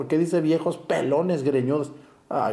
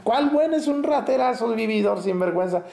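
indignant scoff; 9 semitones higher than usual. Real, not acted. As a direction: burst out in disbelief. Priest scoffs at praise request.

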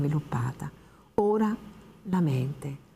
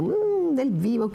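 savouring wine; 9 semitones higher, 3 dB louder, slower than usual. Acted. Old woman savouring wine.

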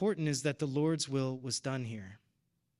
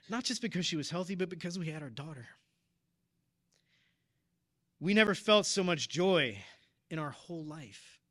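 getting emotional. Real, not acted. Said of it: "I'm sorry I'm getting emotional".

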